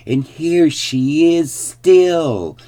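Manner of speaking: high, loud, drawn-out squeak; joyful squeaky baby bear. A narrator's reading. Baby bear discovers the sleeping girl, joyful squeal. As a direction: squeal the happy discovery slowly. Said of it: "squeaked the baby bear joyfully".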